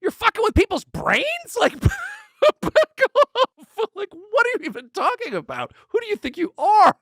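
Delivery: very high, incredulous outburst with a laugh; mock disbelief. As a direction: squeal in mock outrage at the absurdity. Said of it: "no one in the room was like".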